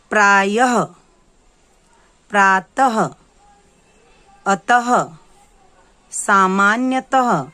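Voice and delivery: each word spoken loud and slow, with the final breathy ह sound of the visarga clearly voiced; slow, loud teacher model for children to repeat; slow clear dictation. Shown as a demonstration. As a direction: carefully sound out the ह at the end of प्रायः and प्रातः. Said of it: "उनका मैं वाचन करती हूँ".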